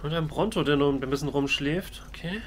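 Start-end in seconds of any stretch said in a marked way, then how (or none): none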